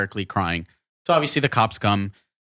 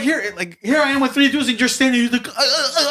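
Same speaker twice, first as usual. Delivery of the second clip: mocking stammer; 13 semitones higher, 3 dB louder than usual. Mocking the frozen assistant.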